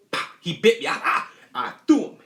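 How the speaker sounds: sudden loud jolt in the voice; startled yelp. Retold or imitated. Retelling the mouse bite, a sudden loud startled burst. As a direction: blurt it out like you just got bitten.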